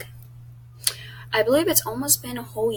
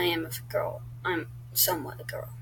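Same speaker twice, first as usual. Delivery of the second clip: whispered, half to herself; sheepish unvoiced aside; 2 semitones lower, 8 dB quieter than usual. Real, not acted. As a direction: breathe it out in a shy whisper. Shy correction of own answer.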